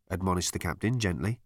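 plain reading voice

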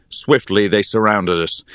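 ordinary delivery

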